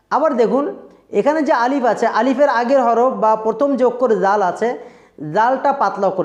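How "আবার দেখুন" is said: ordinary talk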